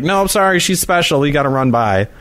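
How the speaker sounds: high-pitched polite excuse, easing down; acted sing-out apology while hurrying past; apologetic brush-off. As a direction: excuse yourself to strangers in a high voice. Acting out brushing off passers-by.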